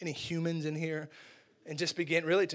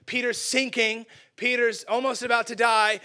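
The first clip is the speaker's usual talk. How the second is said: ordinary talk, nothing marked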